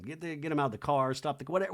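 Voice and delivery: normal talking voice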